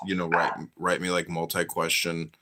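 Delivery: normal talking voice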